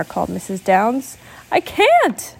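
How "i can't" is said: high, plaintive protest; stuck on the ceiling, can't move; helpless. Acted.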